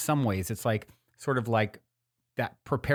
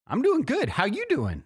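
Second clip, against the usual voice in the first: much higher, lilting voice of a calmly confident stranger; homeless man's serene vibe; 12 semitones higher than usual. Retold or imitated. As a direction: float the greeting up high, unbothered and kind. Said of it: "that was the vibe".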